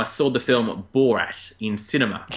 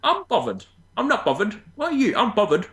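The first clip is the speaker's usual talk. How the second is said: very high, bouncy mock cockney; sing-song bad cockney impression; terrible cockney accent; 10 semitones higher than usual. Host repeating the catchphrase for laughs, hammed-up cockney accent. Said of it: "that's a terrible accent".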